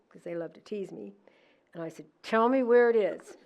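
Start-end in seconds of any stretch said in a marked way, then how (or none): 2.2-3.4 raised, louder, half-laughing accusation; demanding the truth with a laugh; retold accusing demand. To the teasing son, a loud laughing demand.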